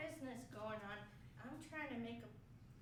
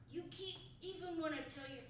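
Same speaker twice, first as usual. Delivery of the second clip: ordinary talk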